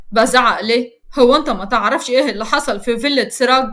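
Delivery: ordinary delivery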